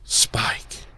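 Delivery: breathless stunned whisper; whispered Spike. Retold or imitated. Wood realizing Spike killed his mother, a stunned whisper. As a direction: whisper the name in shock.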